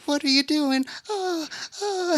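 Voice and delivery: high frightened squeak; high mock-terrified cry; scared little elephant. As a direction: panic like a tiny toy. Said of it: "she is terrified".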